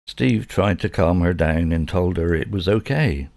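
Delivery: ordinary delivery, nothing marked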